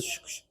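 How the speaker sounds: quick hushed "shush" sound effect; short whooshing bursts; imitated turbulence whoosh. Shown as a demonstration. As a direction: mimic the plane jolting with shushing noises. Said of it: "así".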